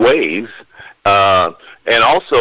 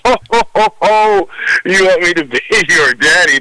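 Santa laughter; 7 semitones higher than usual. Real, not acted. Santa laughs at child's wish.

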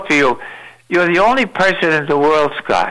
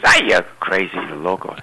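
put-on low scoffing old man; lightly voiced old man calling her crazy; 8 semitones lower than usual. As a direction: grumble it off as crazy talk. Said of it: "And he says".

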